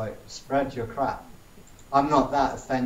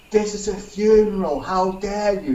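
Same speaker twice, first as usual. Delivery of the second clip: higher and louder, mock outraged shriek; imitating an outraged protester; 6 semitones higher, 5 dB louder than usual. Protester storms over at a funeral stunt, mock outraged yell. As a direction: lampoon his indignant outburst, pitched up. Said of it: "this guy came storming".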